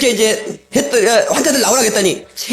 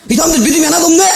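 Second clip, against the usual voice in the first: exasperated outburst; 8 semitones higher, faster than usual. Retold or imitated. Mock exasperation at faithless people.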